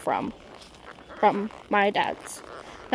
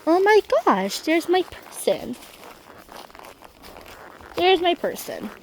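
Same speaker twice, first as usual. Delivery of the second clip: high, excited voice for the horse's joy; a child voicing her horse, high and happy; 7 semitones higher than usual. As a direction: voice the horse greeting her person, delighted. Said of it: "She's like".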